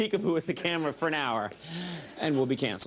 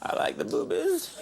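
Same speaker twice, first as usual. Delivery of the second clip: Bill Clinton impression; 12 semitones higher than usual. Host mimics the president for a cheap laugh, grinning Clinton voice. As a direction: purr it like a smitten Southern president. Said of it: "Remember when that was my Clinton impression?".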